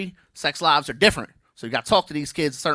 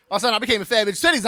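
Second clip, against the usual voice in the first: rushed repeat; 5 semitones higher, much faster than usual. Shown as a demonstration. Comic repeats the joke to the crowd, fast. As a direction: fire the line again quickly. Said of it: "repeated line".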